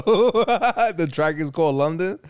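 slow words breaking into laughter; chuckling through the line as it amuses him; laughing while talking. Real, not acted. Laughing to his co-host about the song title.

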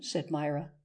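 ordinary delivery

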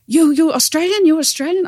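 loud, high-pitched imitation of a Papuan woman's accent; bad Papuan accent. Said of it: "doing a bad accent here".

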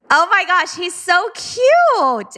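high-pitched adoring squeal, drawn out at the end; gushing. Real, not acted. Host to a proud mom about the child, gushing. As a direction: gush with delight, stretching the last word.

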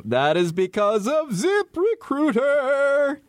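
high, slow brand name hit; ad read. Acted. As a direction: sell the brand name big.

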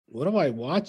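normal talking voice

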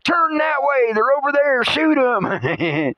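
high, urgent mock shout from the ground; a hint of a screaming rifleman; mock yell. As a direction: call out like a soldier under fire. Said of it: "people on the ground screaming".

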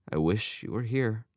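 wistful, understated sigh. A narrator's reading. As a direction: sigh it out to your father. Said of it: "Albert sighed".

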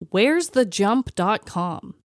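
normal talking voice

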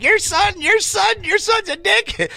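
put-on angry old lady. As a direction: imitate the furious old woman hurling an insult, high-pitched. Angry lady voiced insulting son.

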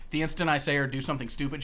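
ordinary delivery

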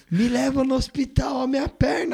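high, slow begging in pain; high pleading. Acted. Injured man begging.

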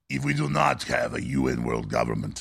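low, lightly German-tinged mock Schwab. Host mocking Schwab with a low German-ish accent. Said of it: "I'm not trying to do Schwab anymore".

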